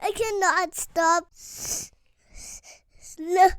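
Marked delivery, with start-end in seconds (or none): none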